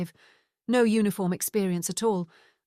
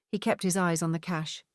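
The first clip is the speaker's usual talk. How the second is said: ordinary delivery, nothing marked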